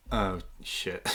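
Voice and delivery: low, soft oh-no; quiet dismayed reaction. Acted. Game master voicing the cornered man seeing the party, quiet dismay. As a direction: let it drop low and hushed. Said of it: "He'll sort of look at you all, like, be like".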